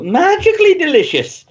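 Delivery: high, lilting mock Irish; cartoon Irish catchphrase; Irish accent. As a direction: sing out a high Irish lead-in. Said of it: "my lead-in words".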